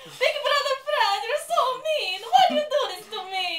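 high, loud whiny voice, slowed, breaking into giggles; Italy. Acted. Italy complains to big brother, high whiny and giggling. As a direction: whine way up high and loud, half laughing. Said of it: "You're Italy".

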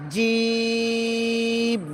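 slow chanted letter name জিম; long drawn-out jim. Shown as a demonstration. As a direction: stretch জিম into one long held note. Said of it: "ভালো করে দেখাচ্ছি".

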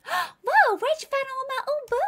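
very high gasping character voice; gasp, then squeal it in character; astonished high-pitched put-on voice; surprised game character.

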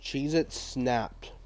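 soft, slow read of the name; understated snack name. Real, not acted. Reading a snack name to himself, softly and slowly. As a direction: say it quietly, drawn out.

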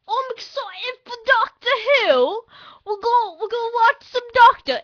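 put-on very loud, high British kid; hammed-up British boy, high and booming; little British boy. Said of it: "do the little British boy voice".

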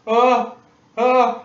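high, loud alarmed hesitation; watching the helper about to botch it; panicked stammer. Retold or imitated. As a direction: freeze up in panic.

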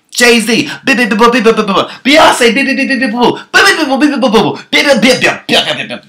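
high, loud gibberish squabble; rapid nonsense bickering sounds; mock argument babble. Couple arguing in gibberish, high and loud. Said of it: "That's how they argue".

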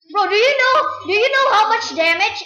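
high, loud, urgent question; hyped up asking a friend; excited. Real, not acted. Excited game rant.

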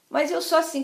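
plain reading voice

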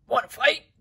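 grouchy ladybug voice. Acted. Ladybug challenges skunk.

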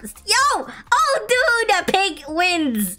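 ordinary delivery

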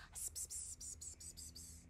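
soft unvoiced pss sounds; whispered psst. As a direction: imitate the kids' whispering. Kids whispering to each other.

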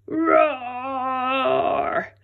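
a six-year-old's loud, high pretend roar; little boy's roar. Max showing the T-Rex how loud he can roar, small boy's roar. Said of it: "Here's how loud I can roar".

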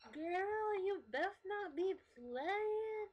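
high, loud, drawn-out sassy warning; over-exaggerated girlfriend. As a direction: stretch it out like an overdramatic best friend. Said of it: "over-exaggerating how they act".

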